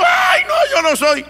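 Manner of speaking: high-pitched, wailing mock cry; imitated panicked cry. Decoy soldier protesting to his attackers, high imitated yell. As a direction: cry out like the mistaken target, pitch leaping up. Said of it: "Pero dice que él gritó".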